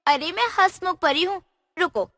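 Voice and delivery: usual speaking voice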